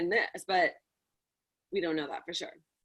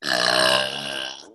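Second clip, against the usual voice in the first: burp. Real, not acted. That's my first burp.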